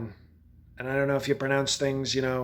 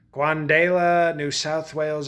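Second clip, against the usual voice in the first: "Quandala" stretched out slowly, louder, every syllable leaned on; slow mocking stress; 4 dB louder, slower than usual. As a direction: drag out "Quandala" as if it were absurdly long. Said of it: "a lot easier to say Colorado than".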